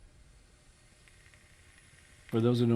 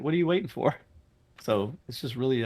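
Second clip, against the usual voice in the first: ordinary talk, nothing marked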